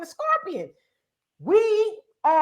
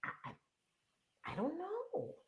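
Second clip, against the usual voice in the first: much softer, almost breathless mumble, embarrassed voter; sheepish mumble; 3 semitones higher, 20 dB quieter than usual. Retold or imitated. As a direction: mumble the excuse under your breath, caught out. Imitating clueless voter mumbling answer.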